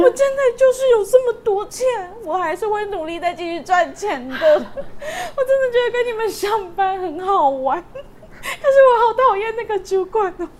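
high, slow mock sobbing with laughter; drunk crying. Drunk coworker crying about his job, high slow mock wail. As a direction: wail it like a weepy drunk through giggles. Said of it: "他就开始哭".

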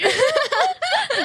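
high, loud laugh; burst of high laughter. Real, not acted. Laughing at her own joke about the company.

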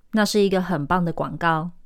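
usual speaking voice

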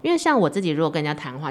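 normal talking voice

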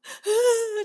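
high mock wail of a sobbing woman; imitated wailing sob. Woman wailing on her bed, imitated high sob. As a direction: act out the ugly crying sound, half laughing. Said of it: "在床上哭到已经".

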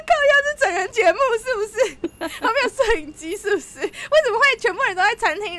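high and loud, laughing exasperation; exasperated laughing rant; laughing disbelief. Real, not acted. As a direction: laugh it out like it must be a prank show. Disbelief at friends in restaurant.

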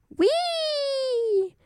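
high gleeful squeal; delighted squeal. Acted. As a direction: squeal like a happy little girl.

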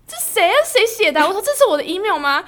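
high, loud fake puzzlement; pretending not to know who sent it; acting innocent. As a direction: play dumb, loud and bright. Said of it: "我还在演".

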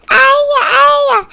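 high, loud cry of alarm; panicked child. Acted. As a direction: wail like a scared little boy. Boy cries out in dream.

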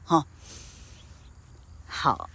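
ordinary talk, nothing marked

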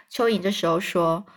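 usual speaking voice

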